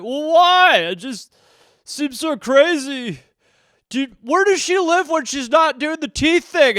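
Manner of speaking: high, stretched, baffled whine; whining man-child. Acted. Grown son questions parents on Tooth Fairy, whining. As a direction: protest like an overgrown kid. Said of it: "twenty-two-year-olds sitting at home".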